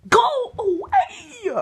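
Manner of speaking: loud, slow, laughing go away; half-laughing shout. Real, not acted. Shouting at the bird tapping on her window, laughing shout. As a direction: shoo it off with a laughing yell.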